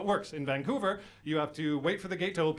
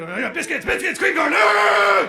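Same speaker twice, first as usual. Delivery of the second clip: street person rant; 9 dB louder, slower than usual. Retold or imitated. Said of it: "He's going".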